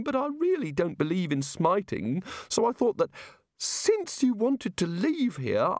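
high, airy voice of a muddled god; a dreamy, absent-minded deity explaining creation; vague god voice. A narrator's reading. Narrator voices the god explaining evolution to wizards, high and airy.

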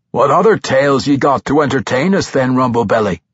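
dwarf teasing the halfling with a grin; smiling dwarf tease. Acted. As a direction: tease him with a wide smile. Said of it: "Bruner asked with a wide smile".

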